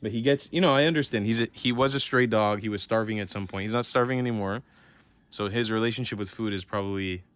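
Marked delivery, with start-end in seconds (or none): none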